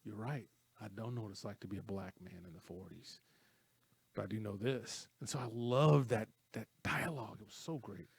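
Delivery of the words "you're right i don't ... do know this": soft, lower, measured reply; understated imitation of a calm answer; retold calm concession. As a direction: concede it quietly, as the mentor did. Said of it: "He goes".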